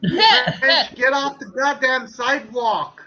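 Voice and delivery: loud New York street yell, laughing at the start; New York accent. Shown as a demonstration. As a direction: bark "get off the goddamn sidewalk" like a New York cabbie. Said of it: "kinda New York and Boston kinda, dialect".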